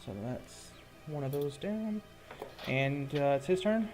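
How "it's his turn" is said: ordinary talk, nothing marked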